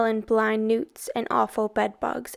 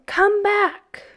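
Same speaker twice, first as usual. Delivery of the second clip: much higher, louder command; thundering god; 10 semitones higher, 4 dB louder than usual. A narrator's reading. Sam Hain orders the boys back, loud and high. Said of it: "thundered the voice above them".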